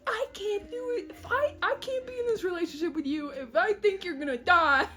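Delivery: high, melodramatic pleading; mock distressed breakup. She-cat scared her boyfriend will die, high mock distress. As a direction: protest high, half laughing at the drama.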